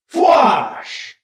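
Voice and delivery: sharp voiced blaster whoosh; blaster zap. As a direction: spit out a laser blast. Third blaster shot.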